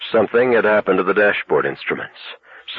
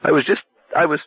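close to tears; 8 semitones higher than usual. A narrator's reading. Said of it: "He sounded close to tears".

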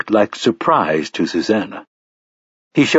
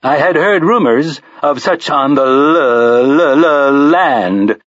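stuttering robot; 5 semitones higher, slower than usual. A narrator's reading. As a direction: stammer like a glitching machine. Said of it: "the robot said".

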